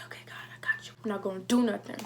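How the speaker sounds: whispered reply to God. Retold or imitated. Answering God that she won't hit her mom, whispered. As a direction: whisper your promise to God. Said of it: "whispered reply to God".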